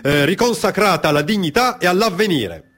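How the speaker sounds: high, ringing oratory for a packed hall; lofty public address, pitched well above the narration; rousing formal speech. Acted. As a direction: declaim it like a patriotic orator at a podium. Reads out a patriotic speech.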